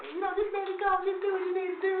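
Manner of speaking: put-on high girly voice, up in falsetto; girl voice. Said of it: "He hit the little, the girl voice".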